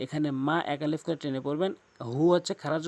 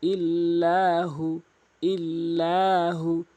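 Quran recitation demo; 2 semitones higher, 4 dB louder, much slower than usual. Shown as a demonstration. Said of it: "লা কিন্তু এক আলিফ টেনে পড়ছি".

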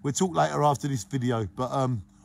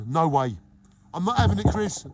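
ordinary talk, nothing marked

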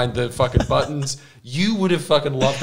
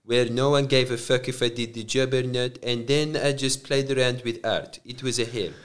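put-on soft Croatian-accented lament; Croatian dad impression; 5 dB quieter than usual. Dad recalls his nightmare job, soft Croatian accent. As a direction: imitate a dad's quiet complaint, Croatian accent. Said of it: "he was like".

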